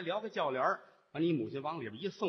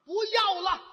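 much higher, loud refusal; stubborn father; 14 semitones higher, 6 dB louder than usual. Acted. Father refuses the bride at his door, high loud cry. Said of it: "你父亲把门一关".

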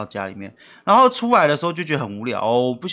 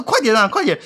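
nagging mom; 7 semitones higher, 5 dB louder than usual. Retold or imitated. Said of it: "她说".

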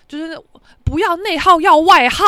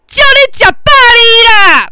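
loud, slow, higher mock blessing yelled back; mocking shout; 9 semitones higher, 12 dB louder, slower than usual. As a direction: bellow it slowly at the old man. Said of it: "我就说". (慢慢地大声吼出来的嘲讽祝福)

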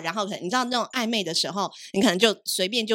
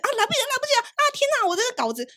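much higher, squealed fake fluster; mock panic; faster than usual. Reporter showing off being late with copy, hammed-up panic. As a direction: put on a frantic deadline panic. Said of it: "但是我一定要那种就是表现".

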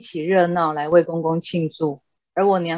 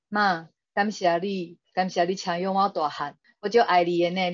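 retold tearful words said in Taiwanese Hokkien, lightly choked. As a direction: plead gently in Taiwanese, voice catching a little. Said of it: "哽咽地说". (用台语，带着一点哽咽地说)

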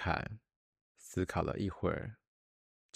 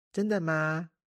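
put-on high, overjoyed little girl; thrilled little princess asking; delighted; 10 semitones higher, 5 dB louder than usual. A narrator's reading. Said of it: "小公主喜出望外".

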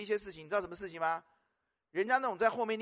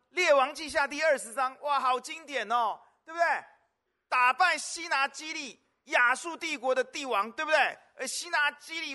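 rousing pulpit proclamation; excited preaching; 5 semitones higher, 5 dB louder than usual. Acted. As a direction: proclaim the victory with fire. Preacher whips up the Hezekiah story.

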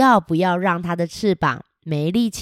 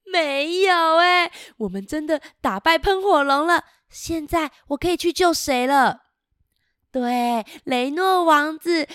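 higher, excited princess voice; happy princess; 7 semitones higher than usual. Acted. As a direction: cheer about beating the dragon. Princess voiced in picture book. (开心的公主)

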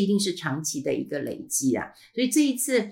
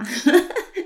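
high laugh; 13 semitones higher, 6 dB louder than usual. Real, not acted. Laughing at own reply.

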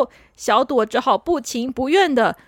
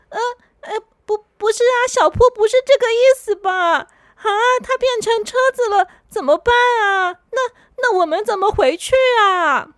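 high, teary panic about the car; a little girl on the edge of tears; stunned girl sobbing out questions; flustered tearful girl; 8 semitones higher, 4 dB louder than usual. Acted. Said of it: "小朵简直傻眼了".

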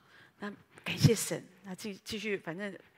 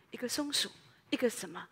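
usual speaking voice

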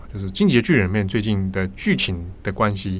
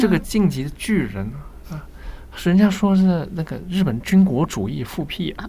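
higher, mock-worried mainland accent; imitated mainland Chinese accent; 7 semitones higher than usual. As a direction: voice the anxious mother in mainland accent. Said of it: "你干嘛学人家口音".

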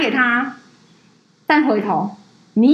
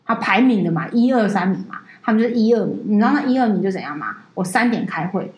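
usual speaking voice

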